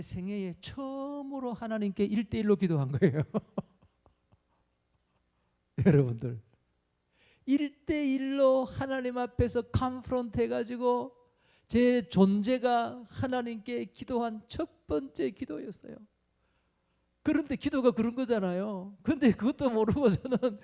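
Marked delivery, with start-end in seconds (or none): none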